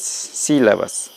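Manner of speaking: slow drawn out. Shown as a demonstration. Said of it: "acá ni consonantes y vocales".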